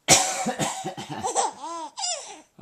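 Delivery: a playful put-on cough; fake cough for baby. Shown as a demonstration. Dad fakes a cough to amuse his laughing baby, playful cough. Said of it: "Daddy's gonna cough one more time".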